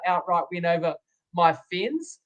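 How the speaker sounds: ordinary talk, nothing marked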